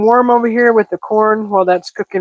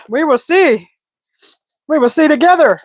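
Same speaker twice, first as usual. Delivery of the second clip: playful singsong; 8 semitones higher than usual. Real, not acted. Playful teasing to viewers.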